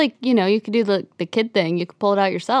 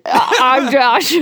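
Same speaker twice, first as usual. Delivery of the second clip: slow, higher weird Josh voice; awkward greeting; 5 semitones higher, 5 dB louder, slower than usual. Pretend. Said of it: "weird Josh impersonation".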